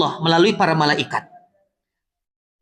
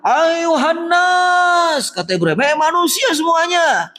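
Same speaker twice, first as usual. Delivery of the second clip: Ibrahim calling out; 10 semitones higher, slower than usual. Retold or imitated. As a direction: cry out from the hilltop to all mankind. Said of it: "lalu berteriak".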